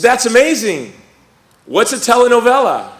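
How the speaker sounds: high, loud, hammed-up enthusiasm; mock amazement. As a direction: play up fake excitement, then blank. Feigned excitement, then confusion.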